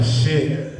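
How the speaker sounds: ordinary talk, nothing marked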